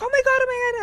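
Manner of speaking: high, loud panicked cry; retold panic. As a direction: squeal it like the bug just hit your eye. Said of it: "I was freaking out".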